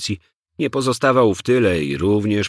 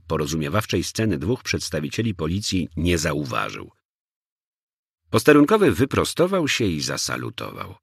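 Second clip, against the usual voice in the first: usual speaking voice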